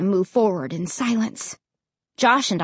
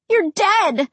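higher-pitched startled blurt; 9 semitones higher than usual. A narrator's reading. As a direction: blurt it out in shock. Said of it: "I blurted out".

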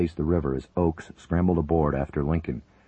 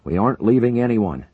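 loud, higher angry order; barking; 7 semitones higher, 8 dB louder than usual. A narrator's reading. Major refusing to abandon the crew, angry bark. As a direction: refuse flat out, shouted. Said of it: "Buchanan barked".

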